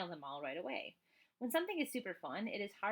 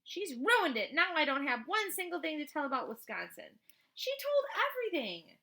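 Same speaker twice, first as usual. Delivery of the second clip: put-on higher and louder, an upset girl crying foul; angry outburst; 8 semitones higher, 7 dB louder than usual. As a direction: protest loudly that she ruined it. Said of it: "Grace F was mad too".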